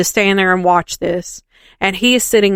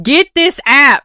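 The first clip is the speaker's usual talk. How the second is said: higher, louder, slow 'Get this app'; excited command; 7 semitones higher, 4 dB louder than usual. Retold or imitated. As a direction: urge him on, hammed-up eagerness. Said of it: "I said".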